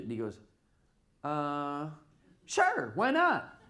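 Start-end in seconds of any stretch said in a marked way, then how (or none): 1.2-3.6 offhand Steve. Retold or imitated. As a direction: toss it off like it's no big deal. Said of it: "Like that".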